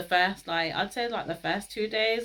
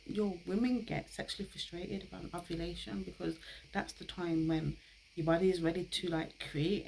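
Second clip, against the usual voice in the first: embarrassed hush; 7 dB quieter than usual. Real, not acted. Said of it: "I don't even know if I'm allowed to".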